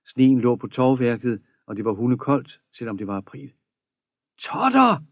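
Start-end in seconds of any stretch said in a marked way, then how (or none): 4.4-5.0 high, sudden call across the quay; wild shout. A narrator's reading. Maria spots Totter from the quay, wild shout. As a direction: yell the name out wildly. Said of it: "Et vildt råb nåede ham".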